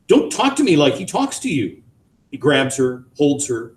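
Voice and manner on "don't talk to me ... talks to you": high, sharp rebuke; bitter retort. A narrator's reading. Wife rebuking husband, bitter and sharp. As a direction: throw it back at him.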